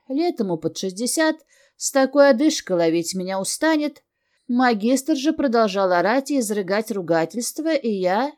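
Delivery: plain reading voice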